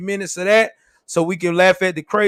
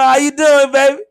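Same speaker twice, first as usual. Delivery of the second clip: put-on higher, louder sleazy "baby"; 6 semitones higher, 6 dB louder than usual. Old dude hitting on wife.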